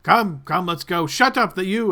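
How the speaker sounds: loud snapping command; impatient guard. Acted. Guard shouting the party along and at a prisoner, loud. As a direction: shout them forward and silence the prisoner. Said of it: "this gentleman looks back towards you and says".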